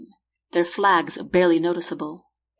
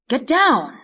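raised, loud command; urgent warning; 6 semitones higher, 5 dB louder than usual. A narrator's reading. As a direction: call it out urgently, pitched up. Warning shout during gunfire.

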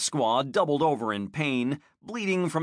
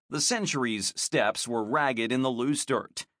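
plain reading voice

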